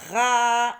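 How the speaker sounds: RA long and loud; isolated syllable. Shown as a demonstration. Teacher gives the second syllable, RA loud and stretched. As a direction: hold out RA firmly on the A. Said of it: "la deuxième syllabe".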